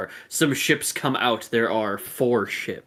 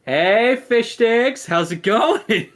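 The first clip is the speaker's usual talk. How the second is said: loud and higher, like a megaphone taunt; through a bullhorn; 11 semitones higher, 6 dB louder, slower than usual. Acted. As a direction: call out mockingly over a bullhorn. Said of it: "turns it on and just go".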